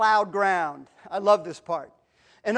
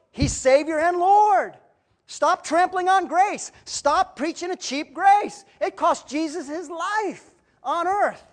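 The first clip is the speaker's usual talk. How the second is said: slow, raised, emphatic exhortation; forceful preaching; 5 semitones higher, slower than usual. Real, not acted. Preacher warning against cheap grace, slow and forceful. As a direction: press each command on the congregation, drawn out.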